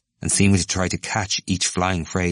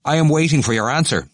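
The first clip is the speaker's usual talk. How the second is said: higher, louder, curt; pointed demand; 8 semitones higher, 5 dB louder than usual. Acted. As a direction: press for the answer. McCann demands Stephen's answer.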